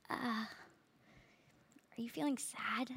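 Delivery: put-on hushed, hesitant old doctor whisper; awkward old doctor. Awkward old doctor asking a crying patient, hushed and hesitant. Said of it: "this really awkward old man comes in. He's like".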